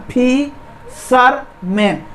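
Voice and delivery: Fisherman slowly, pausing between फि, शर, मैन; three long separated beats on fisherman; split into syllables. Shown as a demonstration. Teacher showing students three syllables, split into syllables. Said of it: "तीन syllable हुआ ना".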